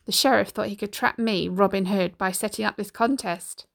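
plain reading voice

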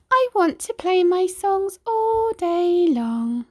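very high, slow small whine; whining radio. A narrator's reading. Radio voiced.

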